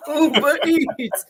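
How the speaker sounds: slow, laughing ad-style Uber Eats; laughing brand callout. Shown as a demonstration.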